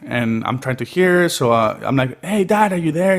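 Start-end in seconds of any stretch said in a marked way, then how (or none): 2.2-3.2 retold raised-voice call, pitched up; calling into a poor Skype connection. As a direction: call out higher to dad over a bad line. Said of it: "And I raise my voice".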